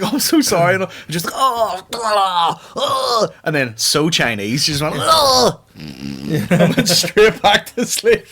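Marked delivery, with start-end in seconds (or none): 0.0-0.7 loud, high-pitched acted apology; flustered mock apology. Acts his apology to soaked woman. 1.4-3.3 high, loud imitated cries; mock distressed wails. Acts the soaked woman's cries. 5.0-5.6 one loud, high-pitched imitated scream; mock scream. Acts woman's scream before sleeping again.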